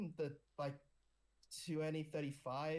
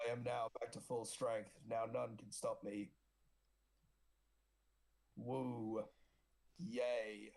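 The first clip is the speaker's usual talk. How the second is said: megalomaniac rant; 4 semitones lower, slower than usual. Acted. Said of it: "I just like doing Kato's voice".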